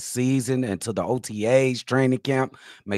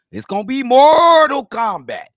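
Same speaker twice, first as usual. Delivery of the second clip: hyped catchphrase shout; 13 semitones higher, 11 dB louder than usual. Acted. Host hyping the open position battles, hyped shout. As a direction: belt it out like a fight announcer.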